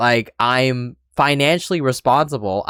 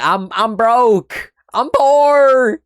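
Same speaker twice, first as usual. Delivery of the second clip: high wail, building louder on the last word; mock panicked outcry; 9 semitones higher, 4 dB louder, much slower than usual. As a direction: cry poverty in exaggerated distress. Acting out own poor-person mentality in panic.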